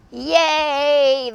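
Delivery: loud, drawn-out hurrah; cheering. A narrator's reading. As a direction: hold a modest crowd cheer. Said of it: "the crowd cheered".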